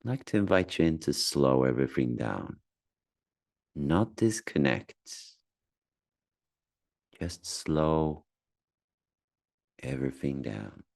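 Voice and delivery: calm, spacious delivery with long silences; unhurried meditation voice, phrase by phrase; guided meditation. Acted. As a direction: gently guide someone through noticing their breath. Leads a guided breathing meditation.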